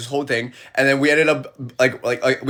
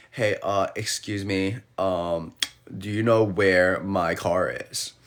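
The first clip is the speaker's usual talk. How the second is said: softer and lower, slow and hesitant; mock polite tourist ask; 8 semitones lower, 7 dB quieter, slower than usual. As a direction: play the lost tourist, timid and slow. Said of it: "I was like".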